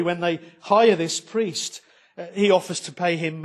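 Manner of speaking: ordinary delivery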